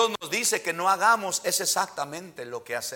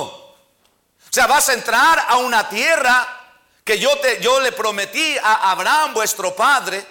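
ordinary talk

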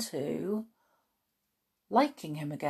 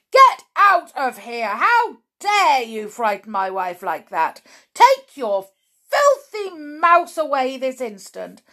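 loud, high, blustering orders; furious shouting; 6 semitones higher, 8 dB louder than usual. A narrator's reading. Mr Jenkins towering over grandmother and boy, shouting. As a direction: roar at them to leave. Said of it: "towering over us and shouting".

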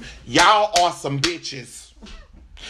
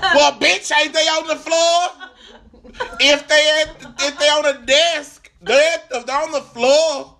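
high, incredulous comic outrage; dropping the godly act; 10 semitones higher than usual. Retold or imitated. Customer questioning Comcast about floor managers, comic outraged rant. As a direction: go off on them, pitch way up. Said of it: "I forgot I said I was gonna keep it godly".

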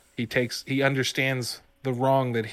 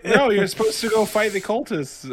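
exasperated DM; 9 semitones higher, 4 dB louder than usual. Retold or imitated. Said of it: "DM objecting".

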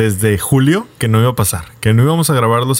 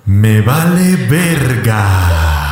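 echo effect; 3 semitones lower, 3 dB louder, much slower than usual. Acted.

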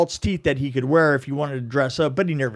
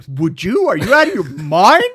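louder retold disbelief, laughing partway; incredulous retort; 3 semitones higher, 8 dB louder than usual. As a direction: fire back in mock disbelief. Retold incredulous comeback to Frank.

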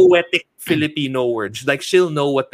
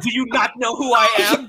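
mock grand villain; 8 semitones higher, faster than usual. Joking as the Lacan character.

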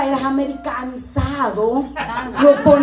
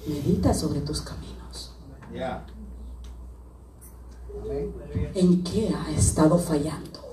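low, much softer prophetic speech; hushed prophetic voice; 11 semitones lower, 10 dB quieter than usual. Acted. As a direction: speak quietly as if under the anointing. Quiet anointed prophetic passage.

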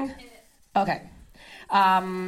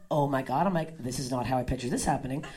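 stunned aside; 10 semitones lower, 7 dB quieter than usual. Acted. Inner reaction to being choked.

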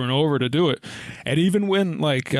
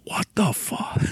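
shocked whisper; 6 semitones higher, slower than usual. Retold or imitated. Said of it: "She's clearly in shock".

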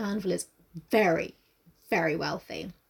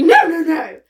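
loud, raised mock refusal; emphatic refusal; 6 semitones higher, 10 dB louder than usual. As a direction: cut the offer off loudly. Acted refusal of coffee.

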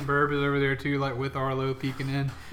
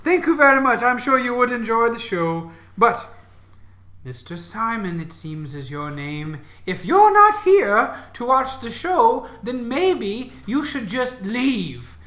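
unearthly voice; 9 semitones higher, 10 dB louder than usual. Acted. The disembodied voice dismisses them.

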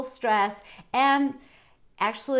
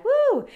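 high, loud whoop as if punching; mock punch cry; 6 dB louder than usual. Host miming a punch on the bag, loud whoop. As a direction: let out a playful whoop like landing a punch.

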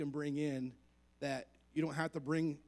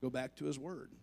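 usual speaking voice

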